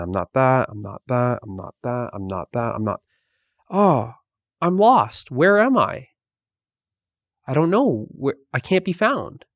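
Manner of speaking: lightly voiced eyeball, rising to lost panic; a hint of a lost, bewildered eyeball; talking eyeball. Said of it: "says the eyeball".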